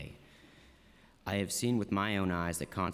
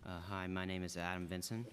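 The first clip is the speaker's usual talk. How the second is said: too quiet; 8 dB quieter than usual. Real, not acted. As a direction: give your name too softly to carry. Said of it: "speak a little bit louder".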